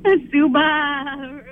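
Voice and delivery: slow sheep-like bleat on the "ba" of "Subaru"; sheep bleat punchline. Shown as a demonstration. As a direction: stretch "Subaru" into "Su-baaa-ru" like a sheep. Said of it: "Do that again".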